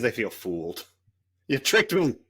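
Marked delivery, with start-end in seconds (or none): 1.5-2.2 higher, louder mock accusation; mock betrayed. Fooled American moviegoer accuses the actress, mock betrayed. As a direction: play the wounded viewer, hammed-up.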